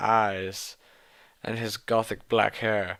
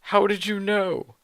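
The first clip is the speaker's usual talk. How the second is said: much higher, hammed-up astonishment; surprised question; 9 semitones higher than usual. Heroine startled that Voldemort knows, mock surprise. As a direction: gasp the question. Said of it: "I asked in a surprised way".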